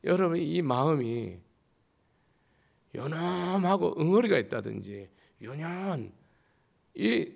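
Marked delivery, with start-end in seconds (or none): none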